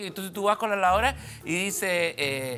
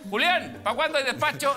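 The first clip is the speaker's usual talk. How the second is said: calling across the shop; 9 semitones higher than usual. Retold or imitated. Shop clerk calls to colleague.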